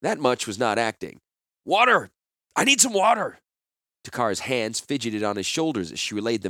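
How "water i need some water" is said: loud, high, gasping plea for water; desperate call. Acted. Gerard faking sickness calls loudly to the crowd. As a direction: beg the street for water as if dying.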